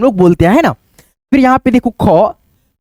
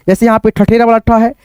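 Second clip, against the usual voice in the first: ordinary talk, nothing marked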